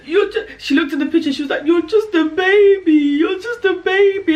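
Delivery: high, loud, laughing mock sob; mock sobbing sister. Sister looking at the baby photo, hammed-up sobbing. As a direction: wail it like a weepy sister.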